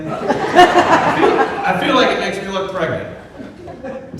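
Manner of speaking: laughing. Real, not acted. Laughing through a fitting.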